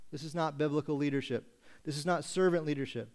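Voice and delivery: usual speaking voice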